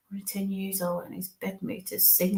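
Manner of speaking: put-on hushed whispered relay of a child's words. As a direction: breathe it out as a whisper. Medium relays a spirit's words.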